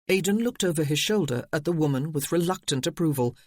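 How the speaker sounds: ordinary delivery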